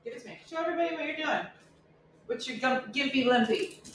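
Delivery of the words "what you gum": usual speaking voice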